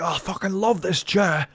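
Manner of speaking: a hammed-up cat gushing over its chair; mock cat voice, slowed right down at the end; voicing the cat. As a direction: give the cat a silly, blissed-out voice, slow on the last line. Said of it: "Love it when cats rub".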